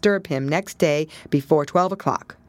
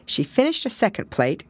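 ordinary delivery

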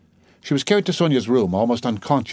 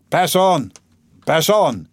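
loud, raised command to a crowd; barked order; 6 semitones higher, 5 dB louder, much slower than usual. Acted. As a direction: order onlookers to move along. Policeman orders crowd to move.

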